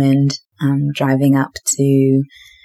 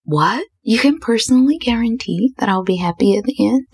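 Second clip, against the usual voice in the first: surprised disbelief; 7 semitones higher than usual. Retold or imitated. Realizing he may propose.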